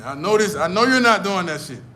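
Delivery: loud, acted insisting; insistent outburst. Acted lover insisting on trust.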